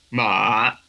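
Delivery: ma dipping, then rising; third tone. Shown as a demonstration. Teacher going through the tones, falling-rising ma.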